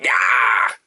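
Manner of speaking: rough, strained "ah!"; hammed-up cry of pain; mock pained yell. Host acting out getting grabbed, mock pained yell. Imitates being painfully grabbed.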